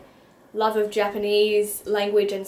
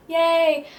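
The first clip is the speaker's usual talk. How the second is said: excited cheer; 7 semitones higher, 4 dB louder than usual. Real, not acted. Cheering the finished stack.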